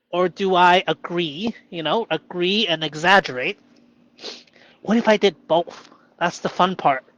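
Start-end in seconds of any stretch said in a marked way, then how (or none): none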